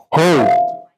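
sudden loud mock divine yell; startling yell. Preacher showing how God is not gonna be, startling yell. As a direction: make them jump with one loud burst. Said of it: "that'll flip you out".

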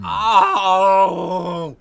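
long, loud drawn-out wail of despair; anguished groan. Acted. Exasperated by parents in the gallery, anguished groan. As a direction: groan out your exasperation at length. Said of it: "You look just like that scream".

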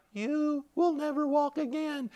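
small, high, soft devil voice; devil's weakened taunt. Retold or imitated. Devil losing ground to a believer, small soft taunt. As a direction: taunt slowly, shrunk to a faint high voice. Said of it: "the devil was like".

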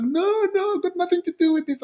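high, rushed protest; mock panicked denial. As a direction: imitate someone frantically pleading innocence. Cousin denies involvement, retold.

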